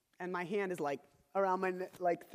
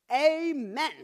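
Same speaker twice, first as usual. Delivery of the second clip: put-on high, blaring child's amen; sudden loud amen; 7 semitones higher, 9 dB louder than usual. Child ends her prayer in dad's ear, sudden loud shout. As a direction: blurt it out at sudden full volume. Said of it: "she was gonna do the increase in volume".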